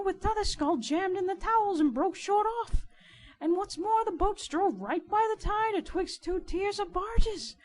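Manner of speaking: high, loud, mounting excitement; astonished boatman, voice climbing; agitated riverside informer. Acted. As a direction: pile up each discovery, building to a high peak. Riderhood reports his startling find.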